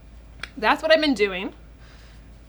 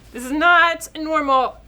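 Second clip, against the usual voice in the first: real tearful; 4 semitones higher, 4 dB louder than usual. Real, not acted. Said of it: "I don't even know why I'm crying".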